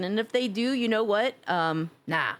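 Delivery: ordinary delivery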